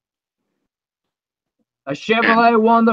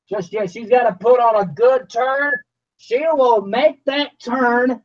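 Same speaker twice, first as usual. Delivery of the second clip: play-by-play; 3 semitones higher than usual. Acted. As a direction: lively race call. Live monster truck race call.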